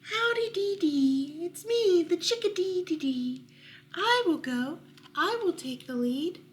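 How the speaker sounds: high, loud chirping bird; chirpy chickadee. Acted. Chickadee volunteering to lead, high chirpy call. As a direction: chirp the dee dees.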